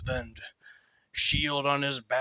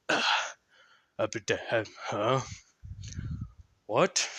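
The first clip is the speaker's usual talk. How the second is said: ordinary delivery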